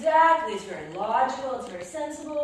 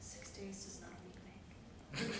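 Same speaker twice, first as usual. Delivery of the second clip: much softer and lower; hushed retort; 6 semitones lower, 17 dB quieter than usual. Acted. Wife quietly delivering a cryptic line to her husband. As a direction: say it flatly, barely aloud.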